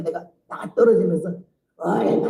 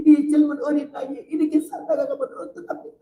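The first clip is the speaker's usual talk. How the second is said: higher, tearful plea to God; crying prayer; 7 semitones higher than usual. Retold or imitated. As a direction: weep through the question in Jeolla dialect. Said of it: "울면서 기도를 하더니".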